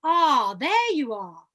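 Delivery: drawn-out, swooping "Ah, there you are"; middle-range projection. Shown as a demonstration. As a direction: sing out the greeting in mid range. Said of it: "Let's play with middle range now".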